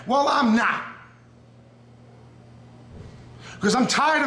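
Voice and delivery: impassioned challenge to a rival, slowing as it peaks; getting emotional. Real, not acted. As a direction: let the feeling push the pitch up and the volume out. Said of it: "now I'm getting emotional".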